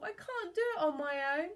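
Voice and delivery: high, helpless whine; hammed-up self-pity; tearful blustering. A narrator's reading. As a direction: whimper it like a lost pirate. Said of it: "blustered Captain Stubble".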